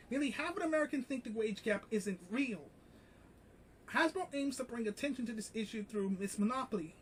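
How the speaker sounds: quiet, quick reading of a news article. Acted. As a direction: read the article out quickly and softly. Reads article quietly and fast.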